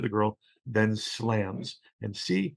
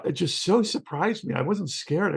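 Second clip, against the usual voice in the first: ordinary delivery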